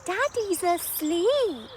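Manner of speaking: put-on girl pointing out sleeping daddy; child's surprise. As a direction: exclaim like a little girl. Child spotting sleeping dad.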